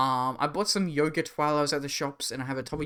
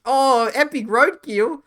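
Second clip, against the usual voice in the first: slow, loud, high mock-cheery Aussie; slow hammed-up Australian cheer; mock Australian accent; 10 semitones higher, 10 dB louder, slower than usual. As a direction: drag out the fake delight.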